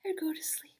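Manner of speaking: put-on soft whisper, like tucking a child in. Shown as a demonstration. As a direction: hush it out under your breath. Bedtime hush after yawn.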